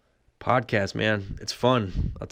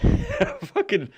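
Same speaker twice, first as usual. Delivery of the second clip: high burst of laughter on the word; breaking into a high laugh. Real, not acted. Laughing at his own story.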